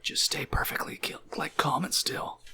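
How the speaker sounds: soft, voiceless warning whisper; hushed, cautioning whisper. Acted. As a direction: whisper to keep them calm. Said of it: "so she whispers that towards you".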